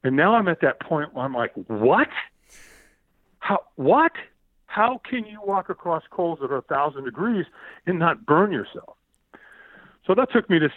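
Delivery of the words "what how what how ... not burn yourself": rising baffled what, then higher questioning; acted disbelief, slowing on the last words; retold astonishment. Seeing no burns on his feet, astonished questioning.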